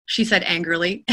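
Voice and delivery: ordinary delivery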